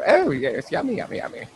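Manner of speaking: high-pitched playful impression; quick high celebrity impersonation; Maggie Smith. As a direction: pitch it up high like a character actress. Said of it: "Just do the Maggie Smith".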